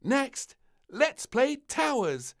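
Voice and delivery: put-on high, slow, proud girl's voice; little princess voice. A narrator's reading. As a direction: announce the prize grandly. Princess awards prizes.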